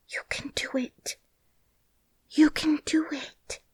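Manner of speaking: urgent high whisper; girl whispering encouragement to herself. A narrator's reading. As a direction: breathe the words under her breath. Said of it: "said Mary to herself".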